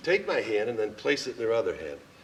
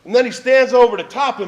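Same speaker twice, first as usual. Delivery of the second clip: excited; 10 semitones higher, 11 dB louder than usual. Real, not acted. Excited retelling of being dragged.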